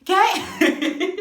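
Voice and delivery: bursting into high laughter; laughing. Real, not acted. Laughing at her own outburst, high and loud. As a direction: crack up at your own story.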